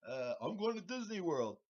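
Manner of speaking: high, giddy shout to mom on camera; trophy winner calling out to his mom, giddy and high; excited winner. Retold or imitated. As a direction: squeal it like a champ waving at the camera. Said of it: "holding the trophy up going".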